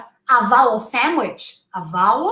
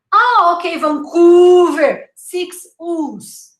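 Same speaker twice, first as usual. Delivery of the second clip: louder, higher mock echo; teasing Canadian dad; 5 semitones higher, 6 dB louder than usual. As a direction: stretch the "oo" in Vancouver teasingly. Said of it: "my Canadian dad, he would say".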